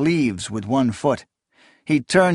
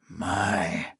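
heavy breath, much softer, lower; breathy exhale; 5 semitones lower, 8 dB quieter than usual. A narrator's reading. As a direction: breathe the word out thickly. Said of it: "exhaling a thick breath".

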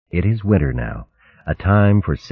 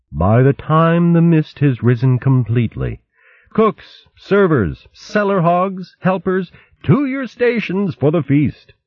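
higher, raised voice summoning the cooks; badger mother calling out; 11 semitones higher than usual. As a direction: call the helpers to their stations. Badger mother calls helpers.

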